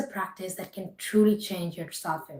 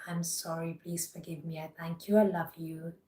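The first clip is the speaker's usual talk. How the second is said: guided meditation; 6 dB quieter than usual. Acted. Repeated affirmations.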